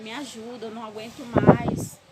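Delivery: begging for help, the last words pushed out loud; retold desperate plea. Woman begging the mayor for help on the phone, mock desperate.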